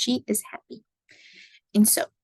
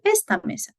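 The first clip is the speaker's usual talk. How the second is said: "esta" stressed on the first syllable, ES-ta, pitched higher; 11 semitones higher, faster than usual. Shown as a demonstration. Teacher contrasting two words for students, first-syllable stress on "esta". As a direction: hit the ES of "esta", let the ta drop. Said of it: "gives us the emphasis".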